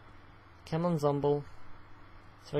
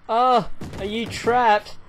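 ordinary delivery

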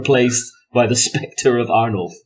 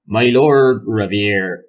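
slow, stretched-out Somerset vowels; halting, drawn-out Somerset character voice; Somerset accent attempt; 4 dB louder, much slower than usual. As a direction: labour through a bad West Country accent. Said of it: "give him your best Somerset accent".

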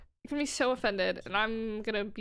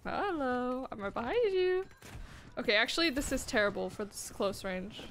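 ordinary talk, nothing marked

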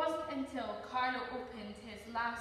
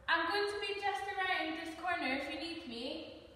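higher-pitched parental call; calling after someone; 6 semitones higher than usual. Acted. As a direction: call after a child who is walking off. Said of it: "Dad called after Carlo".

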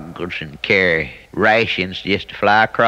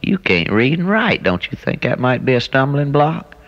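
much higher pitch on a retold challenge; retold incredulous question; 7 semitones higher than usual. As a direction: put it to your cousin, voice climbing high. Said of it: "and I said".

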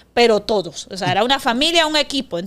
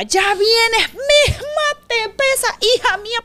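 high, shouted mock scolding of a daughter; imitated father's high shout; 11 semitones higher, slower than usual. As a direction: yell at her like an exasperated dad. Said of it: "mi papá me regañaba".

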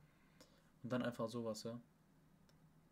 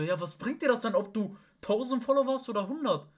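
loud, high-pitched exasperated question; retold quarrel at raised volume; exasperated challenge; 9 semitones higher, 9 dB louder than usual. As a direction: throw it at them mid-argument. Retold quarrel about followers.

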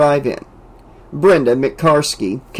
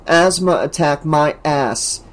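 ordinary delivery, nothing marked

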